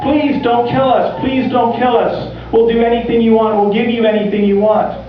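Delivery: high, rushed plea for mercy; frantic begging, pitched well up. Acted. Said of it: "and just begged".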